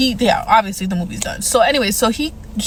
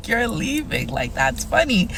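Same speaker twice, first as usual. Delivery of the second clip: amused stranger; 5 dB quieter, slower than usual. Retold or imitated. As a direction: play the guy chuckling at her escape. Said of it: "he's like".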